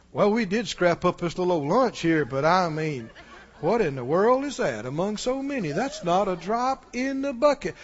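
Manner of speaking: doubting tone. Shown as a demonstration. Preacher demoing unbelief talk, low and softly scoffing. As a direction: shrug the whole thing off as hopeless. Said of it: "Can you hear the difference in the tone".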